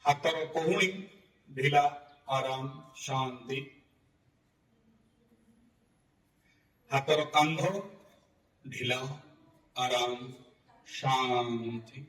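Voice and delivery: spaced-out, soothing phrases that sink away at the end; guided relaxation. Real, not acted. Yoga teacher relaxing students from belly to neck, guided meditation. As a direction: let each word settle before the next, easing down. Leads body-part relaxation.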